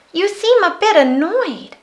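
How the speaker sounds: put-on high, bright-eyed child asking; a curious small girl checks on a sad creature; little girl voice. A narrator's reading. Little girl asks the Yeti what's wrong, high and curious.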